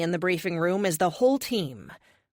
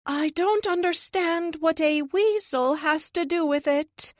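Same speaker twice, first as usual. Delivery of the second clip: much higher, slow, wide-eyed question; earnest alien puzzlement; 10 semitones higher, slower than usual. A narrator's reading. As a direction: ask in total innocence, slowly. Naive alien Lux puzzles.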